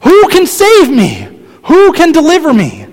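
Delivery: loud, high, slow anguished cry. Retold or imitated. Preacher voicing Paul crying out in anguish. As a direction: cry out in despair, drawn out. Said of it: "he cries out".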